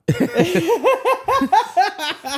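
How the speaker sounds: long, high burst of laughter; real high cackle of laughter; high-pitched laughing fit. Real, not acted. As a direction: crack up high and helplessly. Cracking up at co-host's joke.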